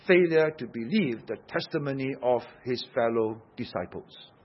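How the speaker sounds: normal talking voice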